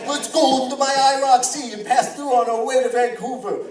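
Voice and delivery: loud, high, brash New Yawk guy; New York tough guy. Acted. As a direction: ham up the loud Italian-American from New York. New York guy voices argue.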